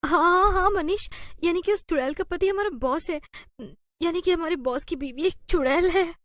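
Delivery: 1 excited realization. Acted. Realizing boss's wife is witch.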